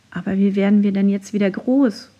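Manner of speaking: ordinary delivery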